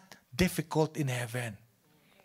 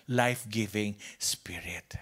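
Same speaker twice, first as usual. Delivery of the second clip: whisper; 9 semitones lower, 8 dB quieter than usual. Acted. Preacher drops to whisper.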